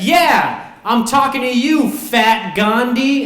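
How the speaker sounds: high, loud, slow jeering yell; bully's taunt. Retold or imitated. Bobby taunting the kid on the swings, loud slow jeer. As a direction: drag out the insult to sting. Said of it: "Bobby said".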